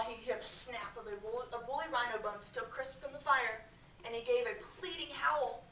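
plain reading voice

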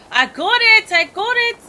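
high, loud burst of triumph; excited shout. Real, not acted. Gamer to herself on finding the tire, excited shout. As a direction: shout it out, thrilled.

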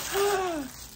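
sharp delighted gasp; excited gasp. Real, not acted. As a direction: gasp in happy surprise. Opening gift, delighted gasp.

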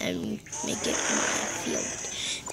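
whispered claim on the field; hushed, unvoiced whisper to oneself. Real, not acted. Player claiming the Rams' field, whispered. Muttered taunt to the game.

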